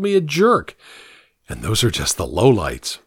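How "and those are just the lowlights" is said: ordinary delivery, nothing marked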